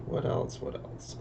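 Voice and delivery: whispered under the breath; whispered, thinking aloud; muttered to self. Real, not acted. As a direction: mutter it to yourself while looking. Muttering to self while searching.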